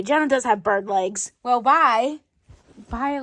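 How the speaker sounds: normal talking voice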